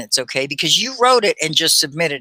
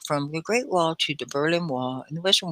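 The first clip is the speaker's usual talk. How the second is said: lazy mouth; 7 dB quieter than usual. Shown as a demonstration. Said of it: "they don't really talk".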